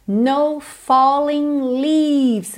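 slow, drawn-out English phrase; slow lyric model. Shown as a demonstration. Teacher introduces the next song line, slow demonstration. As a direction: stretch each word for learners.

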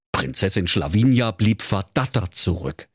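ordinary delivery, nothing marked

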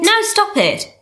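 sharp high NO, cutting in; firm exasperated no. Acted. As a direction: shut the apology down. Friend keeps apologizing.

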